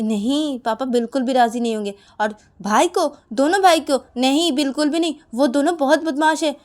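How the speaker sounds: high, loud agitated protest; vehement objection. Acted. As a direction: shoot the plan down, alarmed. Uma protests taking the brothers along.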